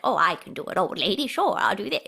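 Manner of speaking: put-on old lady voice. Volunteering at school for the part, old lady. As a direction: answer as an obliging old lady. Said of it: "someone to be the old lady".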